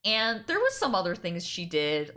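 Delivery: usual speaking voice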